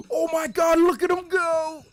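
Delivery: gasp, then a loud, high squeal; real overexcited screaming; thrilled shriek. Real, not acted. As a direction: shriek with delight at what you see. First sight of weasel war dance.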